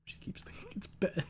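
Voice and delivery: breathy whisper aside. Real, not acted. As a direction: whisper it behind your hand.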